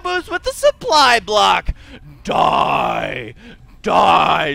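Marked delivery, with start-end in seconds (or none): 2.2-4.5 low shouted growl on "Die"; gritty mock metal scream; Idra's death metal growl. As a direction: bark it like a death metal singer. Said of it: "says Idra".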